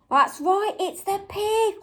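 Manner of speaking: ordinary talk, nothing marked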